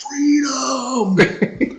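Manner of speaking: high, drawn-out cry held back in volume; mock yell. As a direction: cry out to a crowd, without full force. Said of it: "and he yells out".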